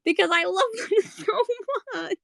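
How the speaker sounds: laughing through tears; crying laughing. Real, not acted. Fan overwhelmed by love for the couple, high and teary. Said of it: "it pisses me off".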